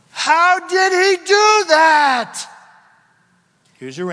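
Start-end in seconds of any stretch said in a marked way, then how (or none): 0.1-2.4 slow, high, roaring question; booming shout. Real, not acted. Preacher to church, booming slow shout. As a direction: shout it out to the congregation.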